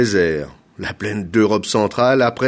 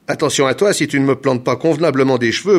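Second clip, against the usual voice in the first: quick, loud, raised warning; threatening; 5 semitones higher, 6 dB louder, faster than usual. A narrator's reading. Governor warning the hair planter to do it properly, threatening. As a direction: warn them sharply. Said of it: "menaça-t-il".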